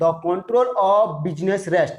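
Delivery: very slow, word by word; drawn-out, separated words. Shown as a demonstration. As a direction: stretch each word out for note-takers. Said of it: "जो भी लिख रहा है बता रहा हूँ".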